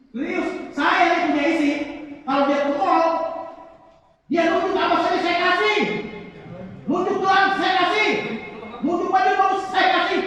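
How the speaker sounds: big hammed-up boast, nearly shouted; emphatic boast. Boasting loudly of generosity to wife.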